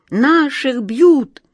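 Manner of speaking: high, loud, slow outraged chant; indignant cry. A narrator's reading. The mice rally against the cat, high loud outraged cry. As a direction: shout it out stretched like a rallying cry. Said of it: "подхватили все остальные мыши".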